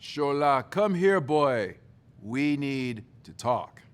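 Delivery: put-on a stern father calling the boy out, slowing at the end; dad's voice. Father summoning his sulking son to talk, commanding. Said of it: "in a voice I can do pretty well by now".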